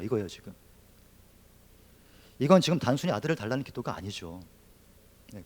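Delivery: usual speaking voice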